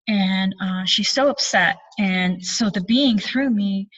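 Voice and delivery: normal talking voice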